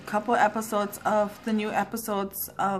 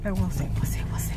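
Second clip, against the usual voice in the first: secretive whisper; faster than usual. Real, not acted. Secret gift she can't reveal.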